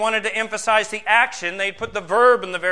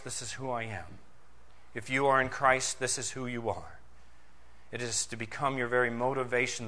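soft, low, hushed pulpit delivery; solemn preaching; 6 semitones lower, 8 dB quieter than usual. Real, not acted. Preacher asking believers to ponder who they are in Christ, solemn and hushed. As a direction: bring it down quietly and let each phrase sink in.

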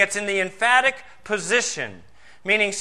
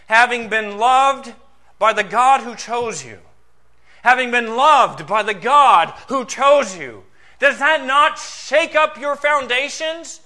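loud, high, measured pulpit proclamation; emphatic preaching; 5 semitones higher, 8 dB louder, slower than usual. Real, not acted. Preacher telling believers they are loved by God, emphatic preaching. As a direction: ring out each phrase, pausing between them.

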